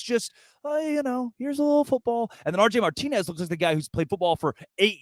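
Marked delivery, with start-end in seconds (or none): 0.0-2.3 high, mock-timid little voice; mock meekness. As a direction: make fun of a feeble throw in a higher voice. Mocking a quarterback's timid throw.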